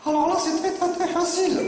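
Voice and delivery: high, confident puppet voice; cheeky puppet. Acted. Puppet solving the boy's problem, high puppet voice. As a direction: answer as a know-it-all dummy, high-pitched.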